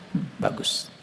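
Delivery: hushed, breathy hmm then bagus; whispered approval. Retold or imitated. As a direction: murmur approval under the breath.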